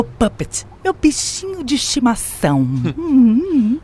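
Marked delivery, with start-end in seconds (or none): 3.0-3.8 mocking laugh. AI mocking her new pet.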